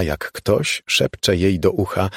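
usual speaking voice

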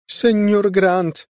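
high, drawn-out alarmed shout; crying out. A narrator's reading. Maid calling Senior Grant after the accident, crying out. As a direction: call out loud and high for help. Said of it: "krzyknęła".

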